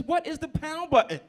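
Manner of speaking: high, loud, slow baffled question; clueless kid. Retold or imitated. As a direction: sound totally lost.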